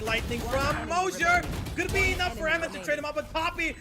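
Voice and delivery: hyped shout over the clutch; rising, fast play-by-play call; esports caster. Real, not acted.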